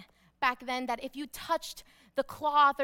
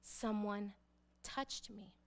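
low, soft, spaced-out words; quietly insistent Jesus; 6 semitones lower, 11 dB quieter, slower than usual. Acted. Jesus answers his disciples, quietly insistent. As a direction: insist gently, fading almost to nothing. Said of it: "And he's like".

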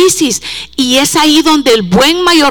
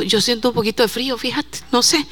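quick, much softer private remark; hushed aside; 10 dB quieter, faster than usual. Real, not acted. Preacher asks a young helper for some heat, hushed aside. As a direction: drop the voice for an offhand request.

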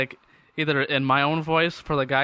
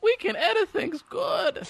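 much higher, slow goofy voice; mock dumb voice; slower than usual. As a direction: play it up like a proud simpleton. Joking self-mockery.

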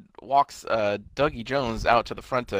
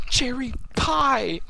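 very high, slow, drawn-out imitation; high-pitched slow-motion wonder; awed announcement; 12 semitones higher, much slower than usual. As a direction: stretch both words out high, as a mock marvel. Acting out character's amazed announcement.

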